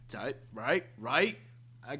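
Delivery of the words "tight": normal talking voice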